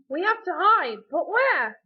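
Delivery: loud, high frantic cry; panicked exclamation. A narrator's reading. Turkey hearing dad coming, panicked exclamation. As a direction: exclaim it urgently. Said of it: "Gus exclaimed".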